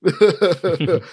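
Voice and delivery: hollow self-pleased laugh; smug fake laugh. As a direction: laugh at your own joke. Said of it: "laughs as though he's hilarious".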